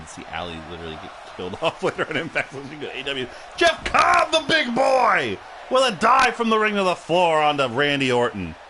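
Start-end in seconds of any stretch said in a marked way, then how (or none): 3.6-7.0 excited call. Real, not acted. Live play-by-play call.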